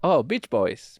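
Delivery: a faint put-on Chinese accent; Chinese accent, lightly done. Shown as a demonstration. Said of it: "It was the same accent".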